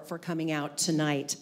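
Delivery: usual speaking voice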